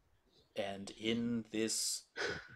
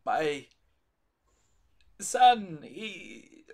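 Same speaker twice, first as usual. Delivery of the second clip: anguished priest; 4 semitones higher, 6 dB louder, slower than usual. Acted. GM voices Donovich.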